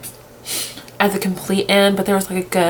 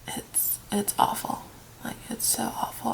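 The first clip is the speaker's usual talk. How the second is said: choked whisper; 3 semitones higher, 12 dB quieter than usual. Real, not acted. Upset fan, close to tears.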